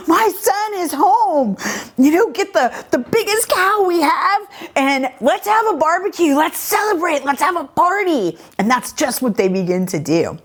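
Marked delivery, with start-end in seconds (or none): 0.1-8.4 high-pitched, jubilant father act; excited father. Retold or imitated. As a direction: announce it as an overjoyed dad, pitched well up. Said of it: "They're so excited that the son is home".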